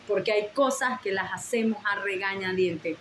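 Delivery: usual speaking voice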